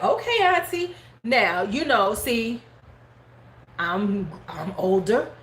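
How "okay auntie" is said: high compliant reply. Retold or imitated. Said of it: "So I'm like".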